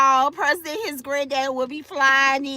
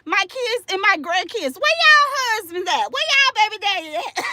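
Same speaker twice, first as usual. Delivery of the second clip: ordinary talk, nothing marked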